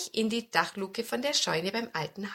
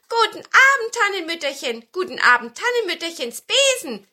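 hoher, lauter Begrüßungsruf; begrüß die Gäste laut mit hoher Zwergenstimme; rufende Zwerge; 8 semitones higher, 8 dB louder than usual. A narrator's reading. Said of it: "riefen die Zwerge". (helles Rufen der Märchenzwerge)

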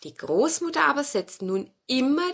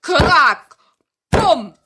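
loud spoken crack and thud; knocking imitation; 6 semitones higher, 11 dB louder than usual. A narrator's reading. Narrator imitates banging on the door, loud crack and boom. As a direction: make a sharp crack, then a boom. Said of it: "da klopfte es draußen schon wieder".

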